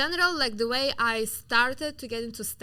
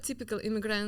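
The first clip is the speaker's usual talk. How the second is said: ordinary delivery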